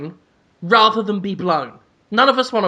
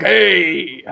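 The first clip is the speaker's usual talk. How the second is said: TV detective impression; much slower than usual. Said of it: "just saying things like".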